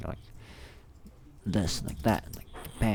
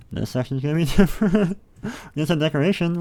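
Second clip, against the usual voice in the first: laughing; 9 semitones higher, 8 dB louder than usual. Real, not acted. Laughing at saving decorations.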